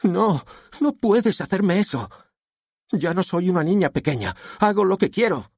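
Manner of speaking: high-pitched, upset protest; rebellious daughter. A narrator's reading. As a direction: defy a father in a high voice. Daughter rebels against father.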